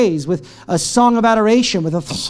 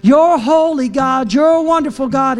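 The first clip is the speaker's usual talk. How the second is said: raised, drawn-out worship declaration; exultant praise; 6 semitones higher than usual. Acted. Paul and Silas begin praising God in prison, exultant. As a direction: proclaim it upward to God. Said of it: "They just began to sing".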